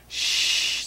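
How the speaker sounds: soft, voiceless shush; quiet warning to keep silent; hushing. A narrator's reading. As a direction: shush someone gently. Boy hushes his sister.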